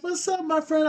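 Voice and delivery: high-pitched imitation of a chummy greeting; friendly hustler. Hustler greeting his mark next day, mock friendly. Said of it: "he was being all friendly".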